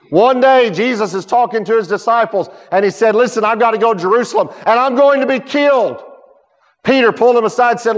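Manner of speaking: high, loud pulpit preaching; preaching at full force. Acted. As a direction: pour it out over the congregation. Preaching the cross story.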